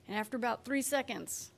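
usual speaking voice

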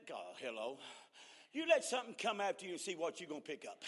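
ordinary talk, nothing marked

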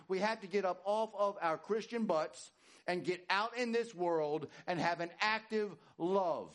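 rising, insistent preacher's repetition; full-force preaching. Acted. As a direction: hammer it again and again like a pulpit climax.

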